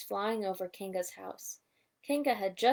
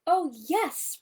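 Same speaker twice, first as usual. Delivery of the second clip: pleased higher oh yes; chuckling; 7 semitones higher, 5 dB louder than usual. A narrator's reading. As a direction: chuckle it happily. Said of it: "Rabbit chuckled".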